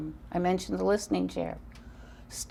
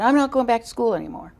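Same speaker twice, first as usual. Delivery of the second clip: fast, louder mock of an upset six-year-old; upset child protesting; 4 semitones higher, 6 dB louder, faster than usual. Mother retelling her son's upset words, louder and fast. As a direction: blurt it out like a hurt little boy refusing school. Son came home upset, crying.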